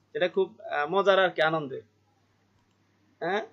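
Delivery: normal talking voice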